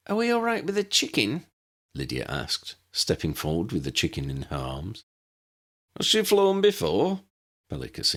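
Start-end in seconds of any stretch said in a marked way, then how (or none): 0.1-1.5 put-on much higher girlish voice; a light, high girl's voice; young woman voice. A narrator's reading. 6.0-7.2 put-on much higher, fussy old wizard. A narrator's reading. Pellicus asks, high.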